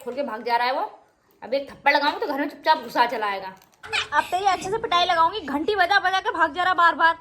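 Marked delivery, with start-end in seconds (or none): 4.1-7.2 raised, louder scolding of her son. Real, not acted. Mother scolding her son who keeps running out.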